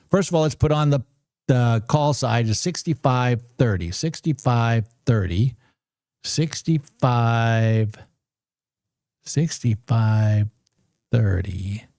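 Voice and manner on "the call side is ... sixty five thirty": slow careful reading. Shown as a demonstration. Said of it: "reads the strike slowly".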